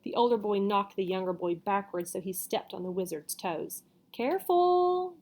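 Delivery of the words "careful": high, drawn-out warning; wizard warning. A narrator's reading. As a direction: caution the boy stepping on you. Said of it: "the wizard said".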